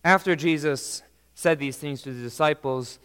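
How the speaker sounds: ordinary talk, nothing marked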